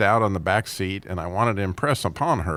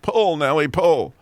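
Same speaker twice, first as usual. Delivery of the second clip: high, loud yell at a horse; 6 semitones higher, 4 dB louder than usual. Acted. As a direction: shout the command across the field.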